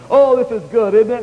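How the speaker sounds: re-enacting his elation; happy enthusiasm. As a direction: gush it to the room. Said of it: "I was so happy".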